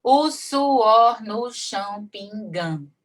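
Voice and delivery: very slow, each syllable pulled apart; syllable count. Shown as a demonstration. Teacher counts syllables aloud for students, drawn out slowly. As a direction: stretch "só Jesus é testemunho" out one poetic syllable at a time. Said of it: "Sete também".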